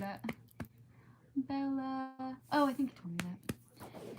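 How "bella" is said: usual speaking voice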